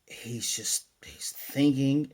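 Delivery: hushed, breathy aside; whisper. Real, not acted. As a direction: drop to a whisper while thinking. Pondering how to act.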